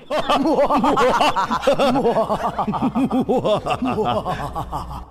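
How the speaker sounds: loud high evil laugh; scary laugh. Acted. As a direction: laugh like a demon at midnight. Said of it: "the scariest laugh of all".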